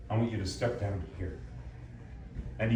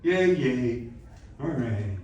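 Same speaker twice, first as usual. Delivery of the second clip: half-hearted cheer, fading away; underplayed cheer; 3 semitones higher, 5 dB louder, much slower than usual. Shown as a demonstration. Coach showing student the weaker option, half-hearted fading cheer. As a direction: let the cheer sag and trail off. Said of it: "Or is it".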